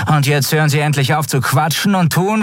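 high-pitched, cold order; ice-cold. A narrator's reading. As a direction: cut off the chatter coldly. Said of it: "wurde ihr Ton kalt wie Eis".

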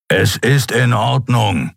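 slow, mildly grumbling reassurance; rumbling reassurance. Acted. Kero insists his wound is fine, mild rumble. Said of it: "versicherte er grollend".

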